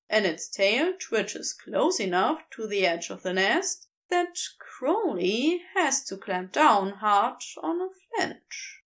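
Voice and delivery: ordinary delivery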